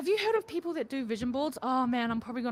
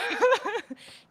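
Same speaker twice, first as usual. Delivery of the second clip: laughing; 6 semitones higher, much slower than usual. Real, not acted. Laughs, then repeats line drawn out.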